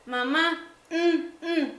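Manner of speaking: niño que no habla. Acted. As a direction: beg in grunts like a lazy-tongued child. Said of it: "el niño dice". (imitando a un niño que pide con quejidos)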